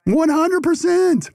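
high, slow, emphatic yes; emphatic agreement. Real, not acted. Guest fully agreeing with the host, high and drawn out. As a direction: really lean into the agreement.

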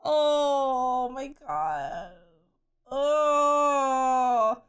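long, drawn-out moan of dismay; upset wail. Real, not acted. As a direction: stretch it into a slow, loud groan. Upset outburst after the episode.

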